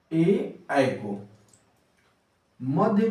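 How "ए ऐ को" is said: ए ऐ spoken very slowly for the class; ए, ऐ held slowly as a demo; slow vowel demo. Shown as a demonstration. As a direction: draw out ए and ऐ. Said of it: "उच्चारण करते हैं".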